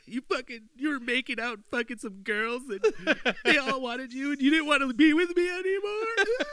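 hammed-up scolding girlfriend; mock angry girlfriend. Imitating girlfriend blaming him for a dream, high and whiny. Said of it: "She's like".

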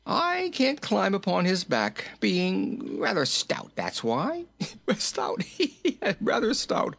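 high voice breaking into giggles; merry, high-pitched giggling; jolly king, tickled by himself. A narrator's reading. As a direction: repeat your own joke through high laughter.

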